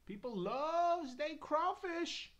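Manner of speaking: high, loud, drawn-out holler; exaggerated slow hollering; hammed-up exclamation. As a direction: stretch it out in a big high voice. Hammed-up repeat of previous line.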